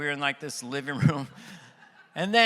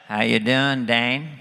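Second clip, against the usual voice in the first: presidential impression, pitched down; George Bush; 5 semitones lower, 4 dB louder than usual. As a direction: do the chummy president, low-voiced. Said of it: "And he's like".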